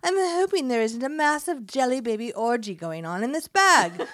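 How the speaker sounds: loud, high mock English accent. Host reading a friend's cheeky note, hammy English accent. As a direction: ham up the English accent with gusto. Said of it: "should I do it with an English accent".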